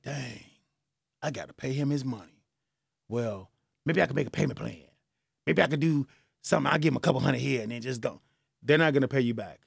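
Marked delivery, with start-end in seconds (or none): 0.0-8.2 lightly acted reluctant borrower, softer and low; mumbled mock reckoning to oneself. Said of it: "they're gonna be like".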